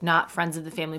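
usual speaking voice

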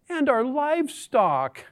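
high, slow mock-tragic lament; melodramatic complaint. Israelites adding their livestock to the complaint, melodramatic. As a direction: draw it out with hammed-up woe. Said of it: "Dramatic here much".

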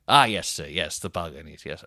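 low, placating staff voice; unbothered hotel staff. Retold or imitated. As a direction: brush off a guest's complaint in a low voice.

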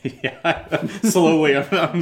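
words pushed out through a laugh. Real, not acted. Laughs while explaining adding music.

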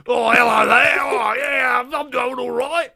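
loud, high put-on Australian-actor accent; hammed-up accent impression, shouted at first; Hugh Jackman. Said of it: "put on Hugh Jackman's accent again".

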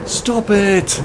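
high, loud desperate yell; shouting over wind. Acted. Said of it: "Karen shouted over the storm".

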